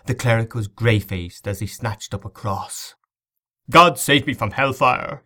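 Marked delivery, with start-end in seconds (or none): 3.7-5.2 loud, high cry for deliverance. A narrator's reading. Terrified cleric calls on God, crying out. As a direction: cry out to heaven in alarm. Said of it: "he cried".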